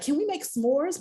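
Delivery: put-on high eager little-girl voice; daughter asking. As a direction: ask it like an excited small child. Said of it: "She was like".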